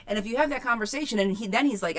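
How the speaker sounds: usual speaking voice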